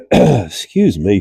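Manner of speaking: ordinary talk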